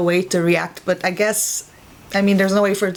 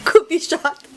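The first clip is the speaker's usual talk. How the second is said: mocking cheesy hero; 6 semitones higher, 3 dB louder than usual. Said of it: "He's so cheesy".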